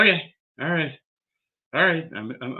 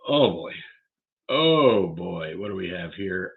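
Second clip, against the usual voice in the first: normal talking voice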